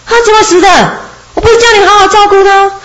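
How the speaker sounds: gasp, then blame the servant fast; shocked master. Acted. Master told the sheep died, high and alarmed.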